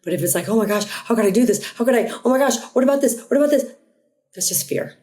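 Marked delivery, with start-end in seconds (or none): 0.5-3.7 fretful rush of worries, climbing higher; mock anxious panic. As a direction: act out a spiralling, overthinking inner voice. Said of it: "not anxious, not loud".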